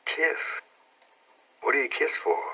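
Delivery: high, hushed shocked question; be startled by the word kiss; surprised girl. A narrator's reading. Becky surprised, high and breathy.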